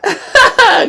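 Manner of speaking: high-pitched burst of laughter; laughing outburst. Real, not acted. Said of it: "sorry for my outburst".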